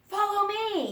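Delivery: high, loud call to follow; cried out. A narrator's reading. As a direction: call the brothers after you. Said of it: "he cried".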